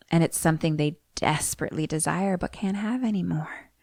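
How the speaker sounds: hushed, drifting into whispers, eerie medium; Tangina's spirit speech. Acted. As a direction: intone it like a mystic medium, fading to a whisper. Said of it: "but I'm gonna do it for you".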